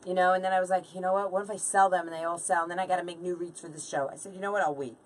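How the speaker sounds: ordinary talk, nothing marked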